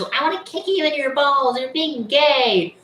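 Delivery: high, whiny mock of the girl. Boy mocking a girl from school in a high whiny voice. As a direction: squeak it out like the girl teasing. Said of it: "She goes".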